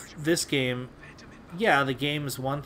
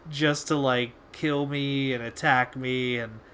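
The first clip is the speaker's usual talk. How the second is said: ordinary delivery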